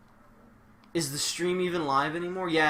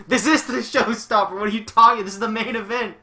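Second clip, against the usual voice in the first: higher, louder laughing insistence; mock protest; 9 semitones higher, 11 dB louder than usual. Streamer mock-arguing with the commentator, laughing shout. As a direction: shout the correction like an outraged announcer.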